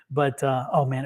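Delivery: ordinary delivery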